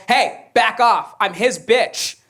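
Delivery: mock yell. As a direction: bark it out at the dog, dragging it. Girlfriend yells at bulldog.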